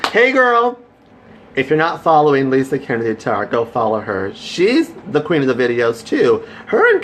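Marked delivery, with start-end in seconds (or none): 0.1-0.8 high, loud, bubbly hello; excited greeting. Real, not acted. Greeting Lisa in the live chat, excited hello. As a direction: sing it out to a friend.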